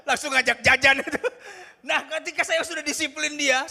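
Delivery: loud words carried on a laugh; amused, laughing delivery; laughing while talking. Real, not acted. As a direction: talk through the laughter.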